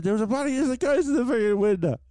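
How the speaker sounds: high panicky voice; panicked retold cry. Telling mum there's a ghost in the window, high panicked cry. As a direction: blurt it out like you're terrified. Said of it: "I said".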